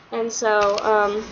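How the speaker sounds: normal talking voice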